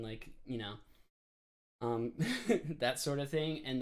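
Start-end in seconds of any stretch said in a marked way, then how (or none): none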